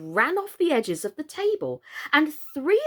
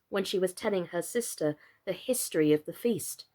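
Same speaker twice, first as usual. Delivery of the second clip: plain reading voice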